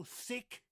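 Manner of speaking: "thick" with a TH at the start; stressed, drawn-out "thick" with TH; clear TH. Shown as a demonstration. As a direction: say "thick" slowly, tongue between teeth for TH. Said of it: "I mean thick, not sick".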